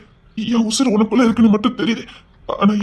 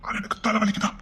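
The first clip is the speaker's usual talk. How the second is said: much softer, breathy whisper to himself; 10 dB quieter than usual. Acted. Father wondering aloud about his headache, whispering. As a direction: whisper it in confusion.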